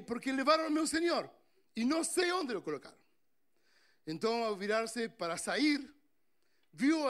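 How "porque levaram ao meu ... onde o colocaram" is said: higher and louder, pleading "levaram" with a falling end; distressed woman. Acted. Woman tells the angels her lord was taken, higher pleading.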